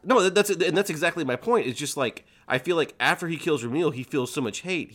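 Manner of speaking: high and insistent, making a point; emphatic. Real, not acted. Host insisting this proves his point, emphatic high. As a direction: voice climbs to drive it home.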